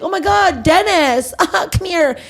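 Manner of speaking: put-on gushing high-pitched girlfriend voice; high excited friend. Imitating a woman greeting a friend, high and gushing. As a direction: squeal it like spotting an old friend. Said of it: "And then she's like".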